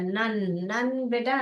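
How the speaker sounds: ordinary talk, nothing marked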